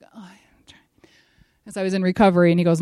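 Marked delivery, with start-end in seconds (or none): 0.0-1.1 slow, barely audible murmur; groggy whisper. Retold or imitated. Patient trying to wake in recovery, groggy whisper. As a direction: struggle to get the words out, half asleep. Retelling waking groggily from anesthesia.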